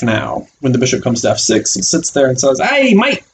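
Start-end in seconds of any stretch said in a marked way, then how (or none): 2.6-3.2 a hint of an Australian accent, high and cheery. Teacher voicing the blocking pawn greeting the bishop, light Australian accent. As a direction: throw a light Aussie greeting. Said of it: "he's got an Australian accent now".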